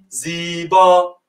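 teacher models زیبا slowly for the class, drawn out; drawn-out model word. Shown as a demonstration. Spell out زیبا syllable by syllable, slow. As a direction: stretch زیبا slowly, long final ا. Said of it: "بگو".

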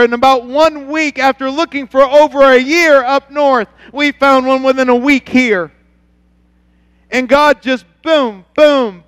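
loud, high, driving sermon voice; fired-up preaching. Acted. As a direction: testify with full conviction to the congregation. Preaching at full force.

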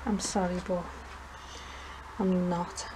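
ordinary delivery

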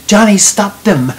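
loud, high cry for help. A narrator's reading. Lucy calls to Johnny from the boat, crying out. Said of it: "Lucy was crying to him".

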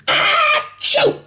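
a big high acted sneeze; loud sneeze. Narrator sneezing again from his cold, big sneeze. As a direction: sneeze it out, hammed up. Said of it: "And then I sneezed".

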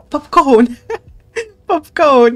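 loud delighted yell with a laugh; excited shout. Real, not acted. Player thrilled at popcorn, loud laughing shout. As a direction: cheer at the popcorn.

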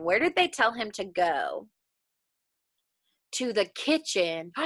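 ordinary talk, nothing marked

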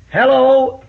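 high, loud hello called out; friendly Southern hello called from the porch; calling hello. Retold or imitated. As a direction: sing out a Southern greeting. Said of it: "they'd say".

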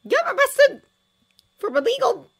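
very high, very loud furious yell; angry shout. A narrator's reading. Stella Hopkins yelling abuse at the arrested man, furious shout. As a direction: scream the abuse at him. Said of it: "shouted Stella Hopkins".

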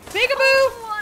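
high, loud singsong taunt; taunting peekaboo. Pretend. Streamer popping out to taunt the woman, high and loud. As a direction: tease her like a child.

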